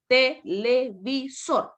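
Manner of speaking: "Televisor" broken slowly into te, le, vi, sor; syllable by syllable. Shown as a demonstration. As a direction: sound out "Televisor" one separate beat per syllable. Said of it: "pronuncio en un solo golpe de voz".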